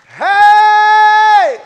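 long, high, very loud shout; sustained pulpit whoop; preacher's holler. Real, not acted. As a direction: holler it out and hold the vowel.

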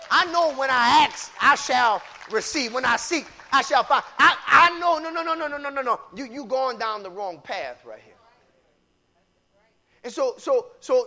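ordinary talk, nothing marked

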